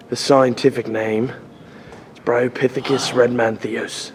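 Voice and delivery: nature documentary. Pretend. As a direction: narrate him like a rare animal. Mock wildlife narration continues.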